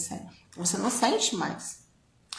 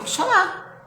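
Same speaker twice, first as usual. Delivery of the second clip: emphatic high chorar; 13 semitones higher, 6 dB louder than usual. Real, not acted. Child cries when hurt.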